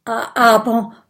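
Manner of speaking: loud, hesitant objection; stammering. Acted. Dragon objecting to the syringe, stammering. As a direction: trip over the first word. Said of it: "stottert der Grasdrache".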